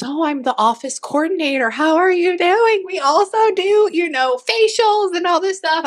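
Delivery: very high mock-chirpy receptionist voice; chirpy office coordinator. Imitating chatty office coordinator's introduction.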